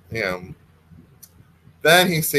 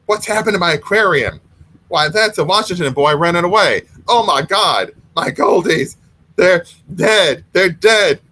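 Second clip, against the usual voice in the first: distressed neighbor; 5 semitones higher, 4 dB louder than usual. Acted. Mrs Miller finds dead goldfish.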